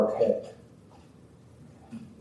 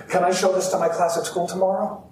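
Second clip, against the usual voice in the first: put-on higher, fast, excited child's plea; eager little boy; 6 semitones higher, faster than usual. Boy asking his mother to share at school, eager. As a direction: rush it out like a thrilled kid.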